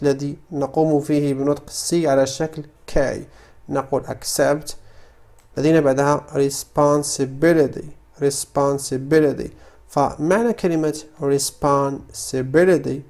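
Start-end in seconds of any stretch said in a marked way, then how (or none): none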